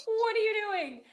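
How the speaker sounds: high squeaky mock friend asking; mock shocked friend. Friends teasing about proposing.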